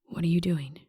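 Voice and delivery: soft breathless whisper. A narrator's reading. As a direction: barely whisper the question. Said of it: "His voice is a whisper when he asks".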